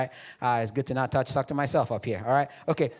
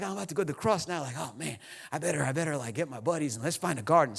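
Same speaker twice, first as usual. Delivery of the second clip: mock Jesus; 5 semitones lower, 5 dB quieter, faster than usual.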